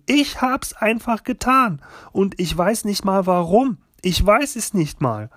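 escalating, anguished self-blame; distraught shouting. A narrator's reading. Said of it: "schrie ich".

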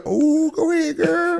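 put-on high sing-song "ooh, go ahead, girl"; teasing cheer.

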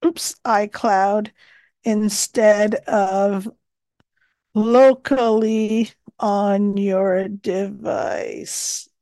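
slow, measured dictation voice; dictating. Acted. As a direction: dictate each phrase carefully. Dictating tip into the note.

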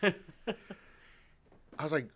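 ordinary talk

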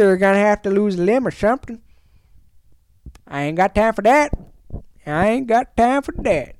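a lightly put-on gruff tough-guy voice, slowed at first; mock tough guy. To a candy-pusher, a lightly played tough-guy warning.

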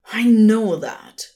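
loud angry snarl. A narrator's reading. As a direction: snap it back in fury. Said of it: "the Son of God snarled".

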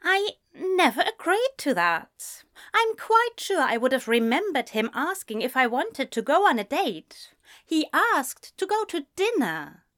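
high-pitched, puzzled protest; prim, insistent denial in character; earnest, baffled friend. A narrator's reading.

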